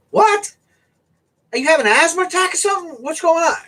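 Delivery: very high, rapid-fire retold questions; loud, hurried mock concern; startled alarm. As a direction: act out baffled alarm, pitch shooting up. Retelling his startled reply to buddy.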